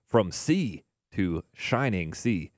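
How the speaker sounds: slow, deliberate title with each word placed; slow down and land the tongue twister cleanly; careful retake. Real, not acted. DJ retrying the album title after stumbling, slow and careful. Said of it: "ooh, that was a tongue twister".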